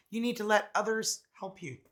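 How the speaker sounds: ordinary talk, nothing marked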